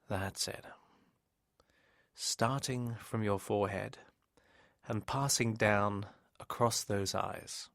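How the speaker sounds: slow, measured hypnosis voice with long pauses; hypnotic relaxation. Real, not acted. Hypnotist guiding a listener to close their eyes and relax, slow and soothing.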